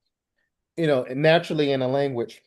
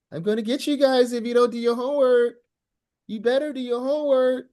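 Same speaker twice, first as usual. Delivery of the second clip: high mock threat to the students; threatening teacher; 12 semitones higher than usual. Shown as a demonstration. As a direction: playfully threaten them about homework. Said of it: "is different than".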